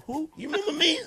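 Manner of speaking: puzzled reply. Acted. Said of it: "I'm like".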